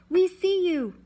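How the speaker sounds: loud, high shout; shout across water. A narrator's reading. Man shouting from across the water, high and loud. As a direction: call out loud to someone far off. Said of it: "There was a shout from across the water".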